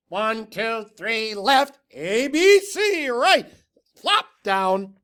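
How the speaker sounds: high and loud, getting it wrong; botched loud cheer. A narrator's reading. Tacky trying the cheer, shouting high and loud.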